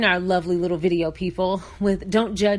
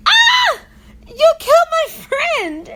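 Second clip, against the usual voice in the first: high, louder, slow wail of grief; anguished shout; 8 dB louder, slower than usual. Retold or imitated. Dave yelling at the girls over Bernice, hammed-up wail. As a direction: howl it over a fallen friend. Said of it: "He said".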